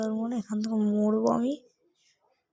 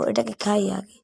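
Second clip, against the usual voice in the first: ordinary delivery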